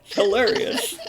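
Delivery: high-pitched, laughing through the words; cracking up. Real, not acted. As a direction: barely get it out for laughing. Cracking up while retelling a joke.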